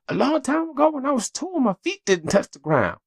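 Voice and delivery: put-on very high chattering child voice; four-year-old daughter. Dad imitating his four-year-old listing random memories, very high child voice. Daughter answering dad's question.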